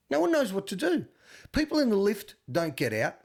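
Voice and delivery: usual speaking voice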